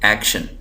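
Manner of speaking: Action, first syllable एक pressed; stress on AK. Shown as a demonstration. Teacher confirming the accented part, stress on एक in action. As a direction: lean on एक and drop शन in action. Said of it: "वो है एक".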